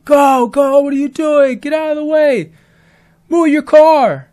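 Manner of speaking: high, loud shouting from the cars behind; impatient drivers yelling. Retold or imitated. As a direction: yell it like an angry driver stuck behind. Impatient drivers honking and yelling at him.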